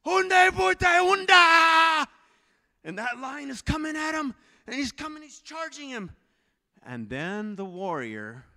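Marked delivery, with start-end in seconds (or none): 0.0-2.1 high, loud, drawn-out defiant yell; warrior's war cry. Acted. Preacher acts the warrior facing the charge, yelling. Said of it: "stands there like this". 2.8-6.1 high-pitched, tense narration; urgent storytelling. A narrator's reading. Preacher narrates the lion charging him, urgent.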